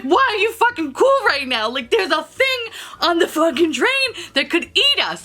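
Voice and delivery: mock panicked outrage; incredulous panic. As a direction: demand it, freaking out. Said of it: "I'm like".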